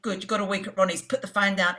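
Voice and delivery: brisk, fast, businesslike booker; quick matter-of-fact. Retold or imitated. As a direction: rattle it off and hang up. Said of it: "really quick, matter of fact".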